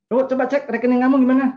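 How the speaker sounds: high, loud, fast mock demand; hammed-up jealous wife checking up; playfully playing a nosy wife asking for the account; suspicious wife demanding.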